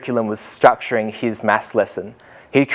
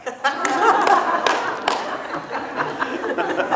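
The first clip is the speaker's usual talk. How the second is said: breathy laugh; 7 dB quieter than usual. Real, not acted. Laughs at own story.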